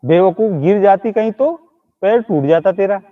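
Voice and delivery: normal talking voice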